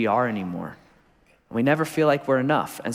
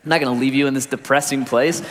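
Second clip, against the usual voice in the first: ordinary talk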